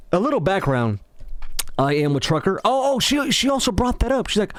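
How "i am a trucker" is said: usual speaking voice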